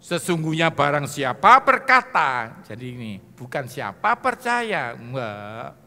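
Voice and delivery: slow, loud proclamation; proclaimed scripture. Acted. Preacher quoting Jesus to congregation, slow and loud. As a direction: boom out the words of Jesus slowly.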